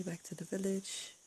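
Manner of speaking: ordinary talk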